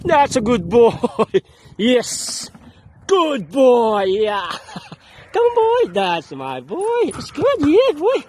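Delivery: high, laughing dog praise; talking to a dog. Real, not acted.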